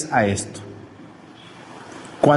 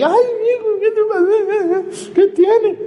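mock fretting mother. Preacher parodies mother fussing over crying child, high whine. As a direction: ham up a panicking mother over a tantrum.

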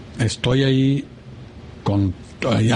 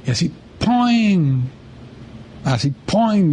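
poing sound effect; 6 semitones higher, much slower than usual. Retold or imitated.

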